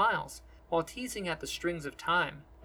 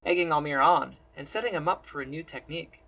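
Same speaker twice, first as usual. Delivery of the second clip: plain reading voice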